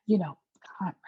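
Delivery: breathy whisper of the name; teasing whispered aside; mock whisper. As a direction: whisper it like a spooky secret. Mocking Michelle's constant mention of Conrad.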